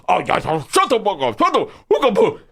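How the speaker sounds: hammed-up squabbling in a put-on voice; mock quarrel. Two men clash in the street, mock squabbling. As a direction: squabble in a caricature voice, leaping high. Acted shouting match continues.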